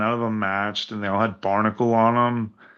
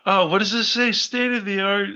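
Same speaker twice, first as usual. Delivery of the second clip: high, mock-impressed brochure reading; higher-pitched, sarcastic awe; 10 semitones higher than usual. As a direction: read the boast as if marvelling at it.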